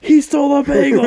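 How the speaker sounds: high, loud mock shriek of an outraged lady; lady's shrieking yell. Retelling a movie lady yelling about a stolen bagel, high shriek. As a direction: shriek it like a scandalized woman. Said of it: "this lady was like".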